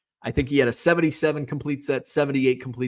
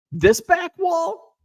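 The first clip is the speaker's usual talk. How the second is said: incredulous; 6 dB louder, much slower than usual. Real, not acted. Reacting to viewer's criticism of wall.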